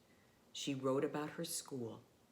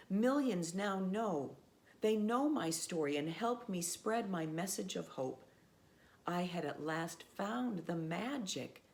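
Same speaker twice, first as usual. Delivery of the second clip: put-on steady storybook read for children; reading a story aloud. A narrator's reading. Presenter reads Malala's story to young listeners, storybook voice. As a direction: tell it like a bedtime book.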